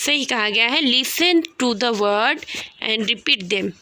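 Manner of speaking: ordinary talk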